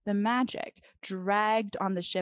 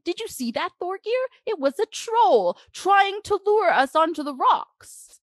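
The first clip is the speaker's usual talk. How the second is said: high, loud and breathless with alarm; excited king; 11 semitones higher, 7 dB louder than usual. A narrator's reading. The king asking Thorgir about the troll, high excited voice. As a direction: blurt it out, still shaken.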